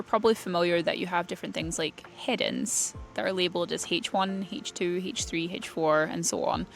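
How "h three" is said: ordinary talk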